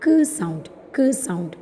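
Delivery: short "kuh" for K, then "sound". Shown as a demonstration. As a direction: say K as "kuh", not the letter name. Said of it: "we are pronouncing it as". (K എന്നത് "ക്" ശബ്ദമായി)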